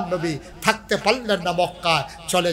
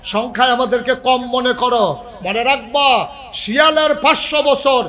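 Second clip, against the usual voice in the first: raised pulpit warning, building; defiant preaching; 6 semitones higher, 7 dB louder than usual. Real, not acted. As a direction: warn the enemy, then rally the crowd. Preaches at full force.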